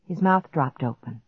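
ordinary delivery

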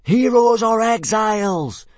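very high, loud, drawn-out chant; fanatical yell. A narrator's reading. Boys yelling back at their instructor, fanatical cry. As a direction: yell it back like zealous recruits. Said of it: "yelled eighteen boys fanatically back at him".

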